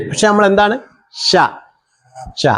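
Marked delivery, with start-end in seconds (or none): none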